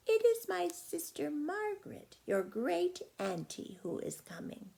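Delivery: a grandmother telling her granddaughter; grandma's voice. Acted. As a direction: speak as the kindly grandma. Grandma voiced in read story.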